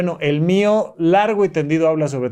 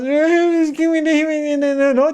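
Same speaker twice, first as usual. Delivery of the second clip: put-on little girl voice; 10 semitones higher, slower than usual. Small girl answering her parent.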